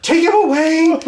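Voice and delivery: high, loud mock British wail; crying British accent. Actress freaking out over a screaming baby, mock British wail. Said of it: "she's got the British accent".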